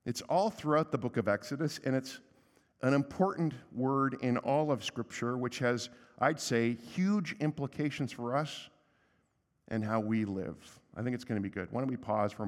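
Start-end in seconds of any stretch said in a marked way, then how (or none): none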